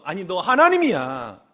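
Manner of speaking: ordinary talk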